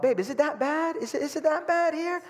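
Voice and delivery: high-pitched, questioning minimizer; minimizing. Retold or imitated. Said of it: "minimizing mode".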